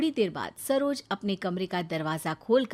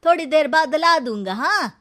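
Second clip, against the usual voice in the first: calling back; 7 semitones higher, 10 dB louder than usual. Acted. Servant calls back from work.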